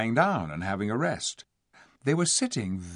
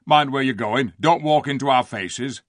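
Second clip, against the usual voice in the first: loud, grumbling rustic voice; surly dwarf; 3 semitones higher, 7 dB louder than usual. A narrator's reading. Dwarf to humans walking near, surly. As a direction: tell them off like a bad-tempered dwarf. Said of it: "in a surly voice".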